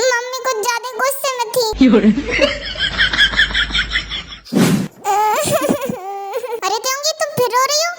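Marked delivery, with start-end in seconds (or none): 1.7-6.6 drawn-out fake wail; mock sobbing. Wife complaining to husband, mock sobbing wail.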